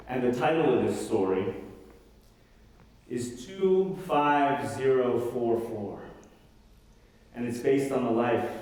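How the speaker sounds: musical storytelling. Acted. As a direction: tell it like a ballad, half singing. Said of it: "one-man musical storytelling version of the show".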